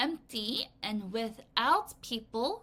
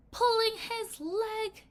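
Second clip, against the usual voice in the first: much higher, slow, gleeful voice; gleeful centipede cry; 13 semitones higher, 4 dB louder, slower than usual. A narrator's reading. Centipede mocking the earthworm, high and slow with glee. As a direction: crow it out slowly, high and delighted, like a teasing bug. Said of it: "he cried".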